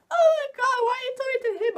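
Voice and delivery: high, loud jealous wail; mock jealous crying. Jealous boy wailing at his girl for talking to someone else, mock crying. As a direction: imitate a tearful, jealous outburst. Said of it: "Bill starts crying because he's, like, jealous".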